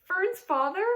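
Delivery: high, loud, tearful question; a pig near tears in disbelief; sobbing. A narrator's reading. Wilbur sobbing about the girl's father. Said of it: "sobbed Wilbur".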